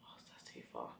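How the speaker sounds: a soft, unvoiced aside; real whisper. Real, not acted. Vlogger whispering to her friend about the Vaseline, hushed. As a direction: whisper it off-mic.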